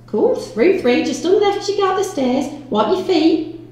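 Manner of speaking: high, loud landlady voice; bossy landlady. Acted. Landlady sending the officer upstairs, high and bossy. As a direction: give orders high and loud.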